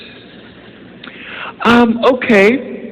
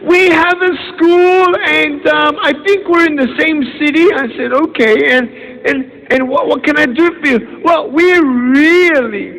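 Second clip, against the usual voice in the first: imitating the caller; 6 semitones higher than usual. Caller asking him to school.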